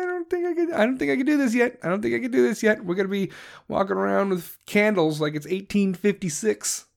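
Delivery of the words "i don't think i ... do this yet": high, dithering whiny voice; mock hesitant Germany. Ranter mocking Germany's hesitation in a high whiny voice. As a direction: play a timid nation that can't commit. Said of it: "Germany's still sitting there like".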